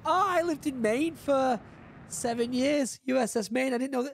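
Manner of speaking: light up over a place you once lived; all excited. Real, not acted. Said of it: "I got all excited".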